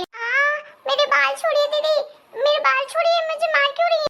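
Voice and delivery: high yelping plea while her hair is pulled; pained protest. Acted. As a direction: cry out as your hair gets yanked.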